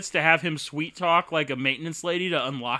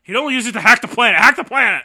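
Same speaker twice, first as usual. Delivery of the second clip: loud, much higher rallying yell; shouting; 8 semitones higher, 10 dB louder, faster than usual. Pretend. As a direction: yell it like a battle cry. Shouted slogan.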